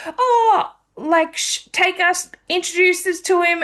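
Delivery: high-pitched imitation of excited friends; eager girls. Two girls asking to meet the guy, eager and high. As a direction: squeal it lightly, begging for an introduction.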